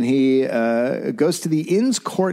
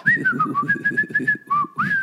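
high laugh. Real, not acted. Cracking up at partner's bit.

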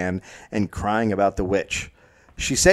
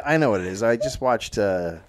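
ordinary talk, nothing marked

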